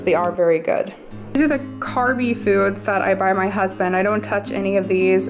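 normal talking voice

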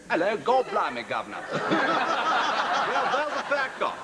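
squeezed, high attempt at Cockney; strangled mock-Cockney, pitched well up; Edward Fox. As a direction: actor hopelessly miscast as an East Ender. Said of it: "you can't really imagine him on EastEnders".

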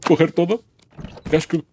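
panicked yelling, high and loud; being chased. Real, not acted. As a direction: scream and flee in a panic. Troll chasing the player.

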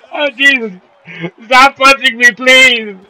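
high, loud hammed-up begging not to be hit; mock panicked pleading. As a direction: play the beaten fighter squealing for mercy. Fighter pleading while being punched.

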